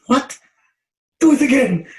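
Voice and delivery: loud, high astonished demand; retold outburst, pitched right up; amazed reaction. Friends hearing his first rhyme, amazed outburst.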